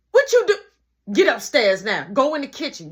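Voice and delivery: high, loud scolding mom; angry mom. Retold or imitated. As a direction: bark orders at the kid. Mom yelling at brother.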